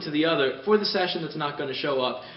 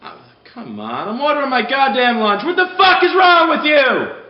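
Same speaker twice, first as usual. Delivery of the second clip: starts grumbling, then bursts into a much higher, louder furious shout; building to a shout; 12 semitones higher, 14 dB louder than usual. Retold or imitated. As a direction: blow up at the caller, louder with each line. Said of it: "picked up the phone and went".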